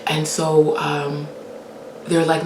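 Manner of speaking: ordinary delivery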